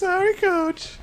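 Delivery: put-on very high girlish falsetto; singsong girly apology; chastened girls' team. Girls apologising to the coach, high falsetto.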